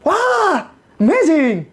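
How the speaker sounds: very high, hammed-up wow; mock amazement. Believer receiving a job from heaven, mock amazed.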